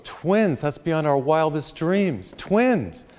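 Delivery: high-pitched delighted disbelief, drawn out; mock-amazed joy, stretched slow; overjoyed new father. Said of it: "he's so happy".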